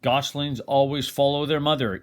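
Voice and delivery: usual speaking voice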